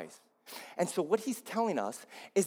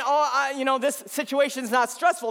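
mock breezy denial; 10 semitones higher, 5 dB louder than usual. Mocking a denial of stress.

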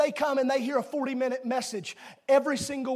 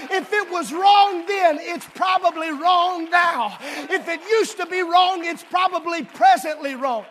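fervent preacher's call, raised; preaching; 5 semitones higher, 9 dB louder, slower than usual. Real, not acted. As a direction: preach it out with full force. Preaching at full force.

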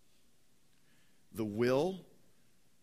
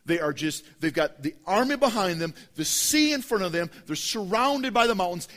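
preacher rising to a dramatic peak; building intensity; 4 semitones higher, 6 dB louder than usual. Real, not acted. Building drama of trapped people.

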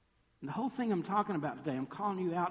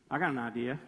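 low-voiced schemer friend; friend with an idea; 6 semitones lower, faster than usual. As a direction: drop low to float a scheme. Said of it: "There's always one friend who goes".

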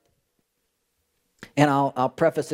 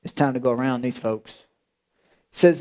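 ordinary delivery

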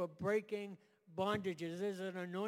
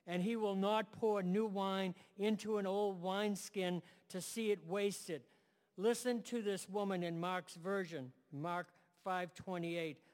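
ordinary talk, nothing marked

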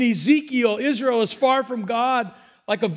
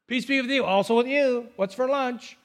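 offhand church greeting. Retold or imitated. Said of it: "It's not just some greeting in church".

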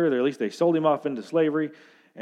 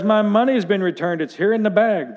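higher, louder alarmed cry; alarmed discovery; 7 semitones higher, 5 dB louder than usual. Acted. Brother calls to his brothers, alarmed and louder. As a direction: blurt it out as the money turns up. Brother finds money in sack.

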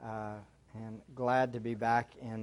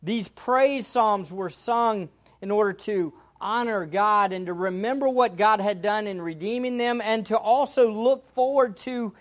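rising, louder sermon delivery; preaching; 7 semitones higher, 6 dB louder than usual. Acted. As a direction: preach it with growing fervor. Preaching to congregation, building.

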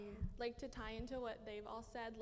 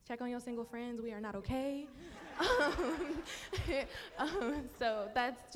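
laughing; 2 semitones higher, 6 dB louder than usual. Real, not acted. Jokes single friends not okay.

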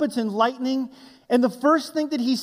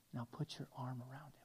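much softer, gentle coaxing; very soft instruction; 9 semitones lower, 26 dB quieter than usual. Retold or imitated. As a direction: quietly coax the child. Rancher instructing girl softly.

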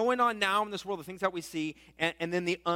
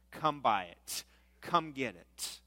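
lightly voiced salesman's lure, low and falling; luring advertiser; 6 semitones lower, 4 dB quieter, slower than usual. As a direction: coax them in, lower and a touch softer. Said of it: "the advertisers are saying this".

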